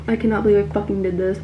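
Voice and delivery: ordinary delivery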